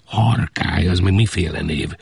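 ordinary delivery

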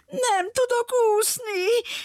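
very high, slow tearful whimper; whimpering girl. Acted. Little girl fears the lion will jump into sea, high tearful whimper. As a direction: whine it like a scared crying child. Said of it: "pityergett a kislány".

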